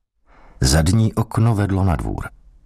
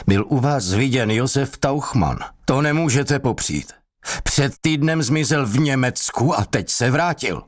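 higher, pressing police sergeant; rising interrogator voice; 8 semitones higher than usual. A narrator's reading. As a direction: push the accusation up in pitch. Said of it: "Hlas vyšetřujícího se zvýšil". (vyšetřovatel zvyšuje hlas)

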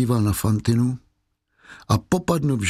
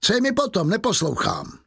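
high, falling burst of impatience; dismissive anger; 8 semitones higher than usual. A narrator's reading. Inspector refuses to listen to his prisoner, irritated. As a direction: wave the plea away, starting high. Inspector rejects the secret plea.

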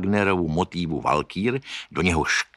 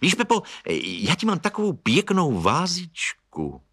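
put-on young woman's voice; 10 semitones higher than usual. A narrator's reading. Minka voiced by reader.

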